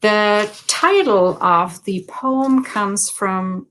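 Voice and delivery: usual speaking voice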